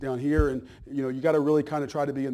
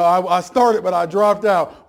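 louder, higher mimicked dropout; mock dropout's excuse; 4 semitones higher, 11 dB louder, slower than usual. Pastor mimicking a dropout's excuse to the church, louder and higher.

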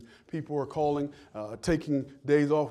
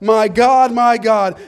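very loud, high preacher's cry; anguished cry; 7 semitones higher, 16 dB louder, slower than usual. Retold or imitated. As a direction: cry out to heaven at full voice. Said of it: "There he cries out".

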